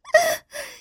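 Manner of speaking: a sharp high giggle, falling. A narrator's reading. Woman laughs at teasing.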